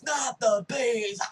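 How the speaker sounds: loud, high, drawn-out panicked scream; hammed-up movie-star meltdown; Nick Cage. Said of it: "Nick Cage status".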